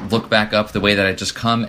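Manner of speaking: ordinary delivery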